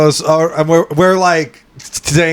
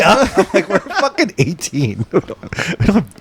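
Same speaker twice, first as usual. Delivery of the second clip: laughing; 4 semitones higher, slower than usual. Real, not acted. Laughing at grandmother's request.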